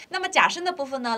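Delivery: usual speaking voice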